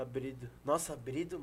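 mock disgust. Real, not acted. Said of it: "eu falo pra irritar".

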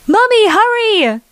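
put-on impatient kid calling mom to the tree; child's shriek. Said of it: "Emily shrieked".

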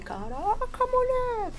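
high astonished exclamation; amazed cry. Retold or imitated. Amazed at sparks from sword.

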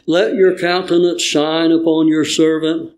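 normal talking voice

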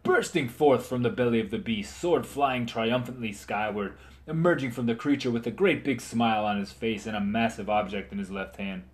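usual speaking voice

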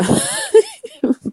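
squeaky high-pitched laugh; real high laugh. Real, not acted. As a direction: burst into a high giggle. Laughs after telling story.

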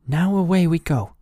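put-on loud, eager young boy; cheerful boy. A narrator's reading. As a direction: set off with excitement. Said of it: "said Hansel".

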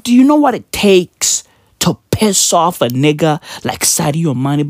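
usual speaking voice

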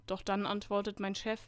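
ordinary delivery

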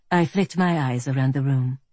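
ordinary delivery

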